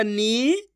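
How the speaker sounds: नी held long with a rising tune. Shown as a demonstration. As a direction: stretch नी out, letting it rise. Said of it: "मेरा तरीका देखना है". (नी को खूब खींचकर)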